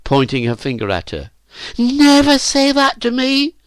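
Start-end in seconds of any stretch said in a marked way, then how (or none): 1.8-3.6 put-on slow, very high, loud girl's shriek; screaming. A narrator's reading. Phyllis leaps up at Virginia's taunt, screaming. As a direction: scream it in outrage. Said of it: "screamed Phyllis".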